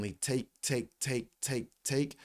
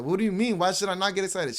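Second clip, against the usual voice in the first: high, mildly incredulous retort; retold protest; 7 semitones higher, 3 dB louder, faster than usual. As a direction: act out a puzzled objection, pitched up. Retold protest to his mom.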